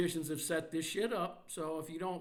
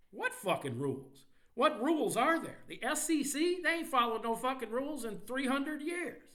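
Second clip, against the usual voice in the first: angry rant; 7 semitones higher than usual. Real, not acted. Ranting against the SEC.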